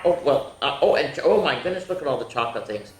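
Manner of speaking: ordinary delivery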